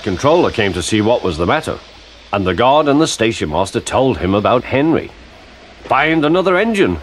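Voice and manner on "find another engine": higher-pitched, lightly commanding; giving an order. A narrator's reading. Fat controller to guard and station master, ordering. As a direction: order it briskly. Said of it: "he ordered".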